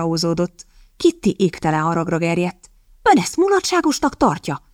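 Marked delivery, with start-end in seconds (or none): 3.0-4.6 indignant attack. Acted. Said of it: "támadta férfira".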